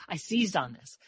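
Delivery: ordinary delivery, nothing marked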